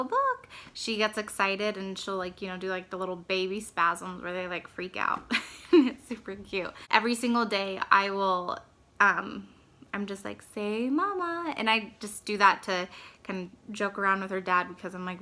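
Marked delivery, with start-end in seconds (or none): 0.0-0.5 squeaky high sing-song "look". Real, not acted. Calling to fussy baby. 10.5-11.6 high, slow, drawn-out coaxing. Real, not acted. Coaxing baby to say mama.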